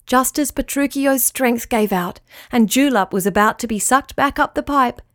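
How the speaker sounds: ordinary delivery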